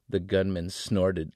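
usual speaking voice

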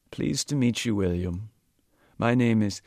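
put-on an elderly gentleman making polite conversation; old Sikh.

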